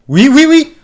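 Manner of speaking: high, loud volley of yeses; excited cheer. Real, not acted. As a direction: cheer it on in a quick triple shout. Cheering three kings. (cri de joie)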